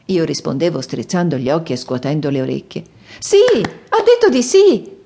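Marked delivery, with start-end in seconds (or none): 3.2-5.0 very high, loud and slow with joy; joyful exclaim. A narrator's reading. Princess tells everyone the elephant said yes, jubilant. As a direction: announce it like a triumph. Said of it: "disse alla mia dolce padrona".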